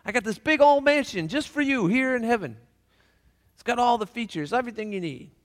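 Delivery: high, lively welcome; welcoming Saint Peter. Acted. Saint Peter greeting the taxi driver, high and welcoming. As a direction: throw the doors open for him. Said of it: "Saint Peter says to the taxi driver".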